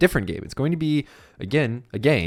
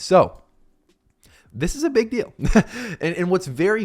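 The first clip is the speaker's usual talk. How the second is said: much higher, hyped-up "big deal"; excited hype; 10 semitones higher, 4 dB louder than usual. Real, not acted. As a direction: sell the news with real excitement. Hyping a news announcement.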